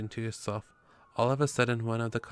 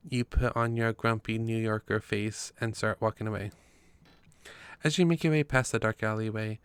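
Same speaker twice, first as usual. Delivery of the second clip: ordinary delivery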